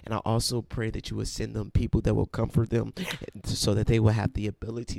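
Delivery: loud preaching prayer, building in force; preacher's prayer rising to a loud, fervent pitch. Acted. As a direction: pray it out with full fervor.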